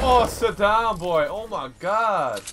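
loud, high hype yell; excited shout. Real, not acted. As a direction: yell it out in triumph. Hype after a kill.

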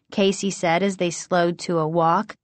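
ordinary delivery

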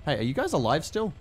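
ordinary talk

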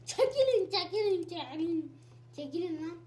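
real gamer shout that winds down; yelling get out of the way. Real, not acted. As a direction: bark at them to move, calming down. Yelling at mobs in game.

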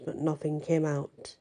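ordinary delivery, nothing marked

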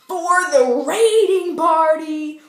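loud, slow game-show hype; hyped announcement. Acted. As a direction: announce it like a party is starting.

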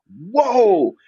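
a high, loud, amazed "Whoa."; imitating dad's surprise. Father hearing his young son play drums, imitated surprised whoa. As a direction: act out your father's jaw dropping. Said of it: "my father was like".

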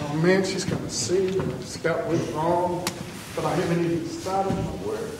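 worried inner voice. Retold or imitated. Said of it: "it's like".

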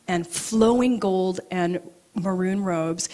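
ordinary talk, nothing marked

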